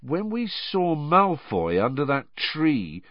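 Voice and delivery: slow, pondering delivery; slowly. A narrator's reading. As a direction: draw it out as it dawns on you. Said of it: "said Ron slowly".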